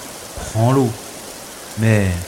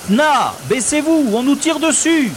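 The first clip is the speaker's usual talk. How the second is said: urgent warning. Acted. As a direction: warn him to get down now. Under fire, warning to duck.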